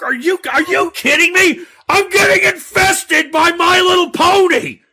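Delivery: outraged shout. Real, not acted. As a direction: shout at being invaded by ponies. Host outraged by pony caller.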